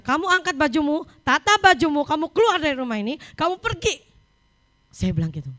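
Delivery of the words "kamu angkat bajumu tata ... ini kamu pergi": higher-pitched, mounting angry commands; harsh parental order. Retold or imitated. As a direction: act out throwing the child out, pitch climbing. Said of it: "keras sekali". (menirukan orang tua marah mengusir, nada meninggi)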